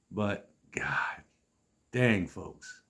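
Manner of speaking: breathed aside, no voice; a hushed, voiceless "But, God"; exasperated whisper. Real, not acted. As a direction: whisper it to the crowd in disbelief. Exasperated aside about Billy.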